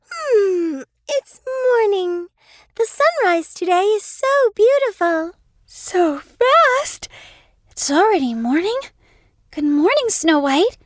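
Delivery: put-on high, quick child voice; a young girl greeting the morning; bright little girl. Sister waking at sunrise, high excited girl voice.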